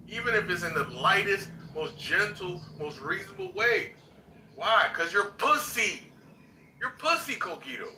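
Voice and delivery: heated call-out. Real, not acted. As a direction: tear into him, voice climbing.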